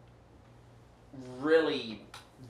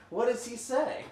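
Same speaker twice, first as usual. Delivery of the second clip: what does he say asked much higher and louder, rising; high mock whine; 14 semitones higher, 7 dB louder than usual. Real, not acted. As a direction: squeak the question up like a stalling GM. GM repeats question, mock whine.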